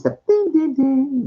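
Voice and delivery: sung high ding ding chime; chime imitation. Imitating metro PA chime again.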